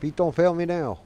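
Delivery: loud, high-pitched call; pleading shout in the moment; urgent plea. Real, not acted. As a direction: cry out hoping it works. Calling out urgently during game.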